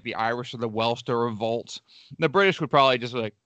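ordinary talk

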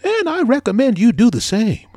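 a diligent little worker explaining; busy earnest ant. Acted. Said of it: "said the ant".

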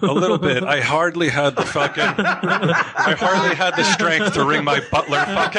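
high laughing spoiled rich snob; drunken posh sneer. Pretend. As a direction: sneer at the butler like a pampered brat. Pampered rich patient bit.

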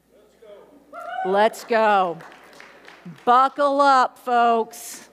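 rousing shout. Real, not acted. Preacher sending congregation off, loud and rousing. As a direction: fire up the church.

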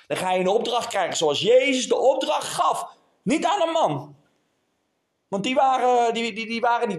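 emphatic sermon punch; forceful preaching. Real, not acted. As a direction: proclaim it to the congregation.

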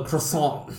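"croissant" with throaty r and silent t; mock-French "croissant"; exaggerated French pronunciation. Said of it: "go out of her way to pronounce it like".

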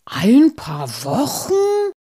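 high, loud, drawn-out disbelief; slow, swooping shocked question; aghast stammering. A narrator's reading. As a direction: echo it in appalled amazement. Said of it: "stammelte Muff".

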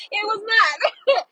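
high, loud, drawn out through laughter; laughing protest. Real, not acted. Vlogger recalling a stranger who laughed at everything, laughing. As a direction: crack up while insisting.

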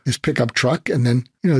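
ordinary talk, nothing marked

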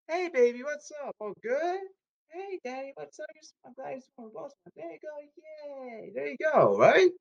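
cutesy voice. Pretend. High cutesy voice.

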